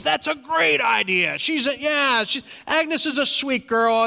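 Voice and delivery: the cook jumping at the idea; bursting with enthusiasm. Retold or imitated. Diner cook agreeing to throw the party, excited shout. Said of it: "And the guy explodes".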